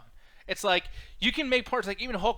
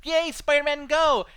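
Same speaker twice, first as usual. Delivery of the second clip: high, loud mock cheer; hammed-up fanboy cheering; 8 semitones higher, 7 dB louder, slower than usual. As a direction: cheer him on with exaggerated, sarcastic glee. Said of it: "I'm making fun of him".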